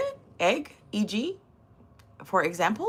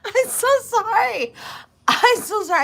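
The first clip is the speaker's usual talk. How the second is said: high, loud, giggly apology; squeaky sorry after a laughing fit; 13 semitones higher, 5 dB louder than usual. Real, not acted. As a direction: apologise while fighting down laughter. Apologising while cracking up.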